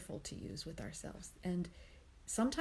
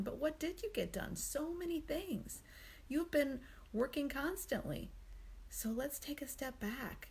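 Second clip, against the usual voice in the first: higher, soothing tone of a kind friend; gentle, accepting voice; 5 semitones higher than usual. Acted. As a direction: reassure a dear friend with loving kindness. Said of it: "I was much softer".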